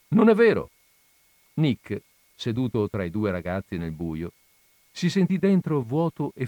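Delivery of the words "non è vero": put-on high, loud childish denial; childish shout. A narrator's reading. Kid shouts back at his brother in the wagon, loud and high. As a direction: yell it back like a squabbling kid. Kids argue loudly in wagon.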